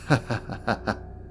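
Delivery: a short, mocking chuckle; amused laugh. Archdemon laughing at the servant's excitement, amused chuckle. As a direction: laugh at how eager they are.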